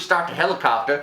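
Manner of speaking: ordinary delivery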